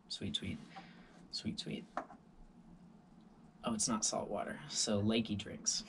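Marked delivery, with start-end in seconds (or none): 0.1-1.8 breathy whispered relish; savouring whisper. Retold or imitated. Swimmer gulping lake water, relishing whisper. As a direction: whisper it like a delicious treat.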